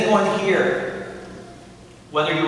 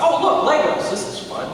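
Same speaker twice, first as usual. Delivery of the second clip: distracted kid brothers; 10 semitones higher than usual. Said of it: "they had the same thought of".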